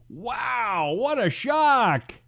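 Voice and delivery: drawn-out mock surprise on "shock"; sarcastic. Real, not acted. Host mocking cyclist's championship win, slow sarcastic. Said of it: "sarcastic about cycling win".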